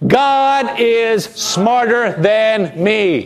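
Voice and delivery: very slow, word by word; slow emphatic repeat. Shown as a demonstration. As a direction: draw out GOD, SMARTER and ME deliberately. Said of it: "One more time".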